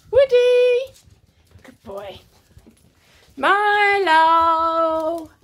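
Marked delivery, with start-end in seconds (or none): 0.1-0.9 loud high call; sing-song long call. Real, not acted. Calling dog from afar. 3.4-5.3 loud drawn-out call; long sing-song name call. Real, not acted. Calling dog, drawn out.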